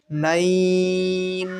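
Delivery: "নাইন" very slow, glide held into a nasal ন; stretched madd lin. Shown as a demonstration. Teacher putting stretch and nasal sound together, "নাইন" held long. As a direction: draw "নাইন" out on one steady note. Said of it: "এভাবে এক আলিফ টান দিব".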